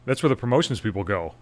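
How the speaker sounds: ordinary talk, nothing marked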